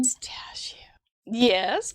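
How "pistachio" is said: hushed, breathy whisper of the word. Real, not acted. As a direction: whisper it like a tempting secret. Reacting to pistachio flavor.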